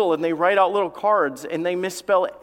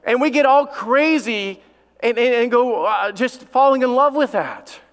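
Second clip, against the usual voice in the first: high, emphatic pulpit delivery; full-force preaching; 7 semitones higher, 5 dB louder than usual. Real, not acted. Preacher on parents falling in love, full-force preaching.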